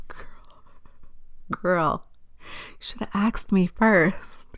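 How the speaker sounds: hushed whispered scolding; whisper to a friend. Retold or imitated. Telling a friend off for a stupid post, whispered. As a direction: lean in and whisper it like a secret. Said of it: "I'm just like".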